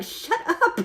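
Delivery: high, hushed shut up through giggles; stifled laugh. Retold or imitated. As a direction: try not to laugh while hushing.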